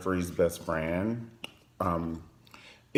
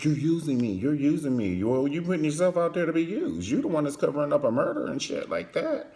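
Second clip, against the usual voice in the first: mock accusing boyfriend; 6 semitones higher than usual. Jealous boyfriend accusing Jeffrey, mock whiny. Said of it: "he's like".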